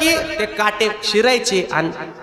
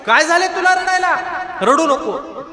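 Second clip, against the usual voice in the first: high, gentle voice comforting a weeping animal; tender concern; 8 semitones higher than usual. Acted. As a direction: soothe her like a frightened child. Tukaram comforting the buffalo. (रडणाऱ्या म्हशीला प्रेमाने समजावणे)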